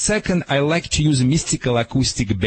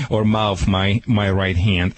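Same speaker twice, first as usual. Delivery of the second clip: normal talking voice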